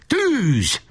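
high-pitched order to open fire; shouted command. A narrator's reading. Leader leaping into the trench, shouting to gunners. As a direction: cry it out high in one burst. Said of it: "hogy elkiáltassa magát".

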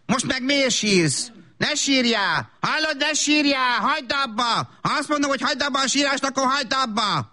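high, insistent telling-off; exasperated scolding. Acted. Mother to her crying child, exasperated scolding.

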